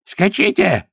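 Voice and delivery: very high-pitched urgent cry; shout. A narrator's reading. The monk tells his friends to ride on, shouting. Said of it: "крикнул брат Зяньян".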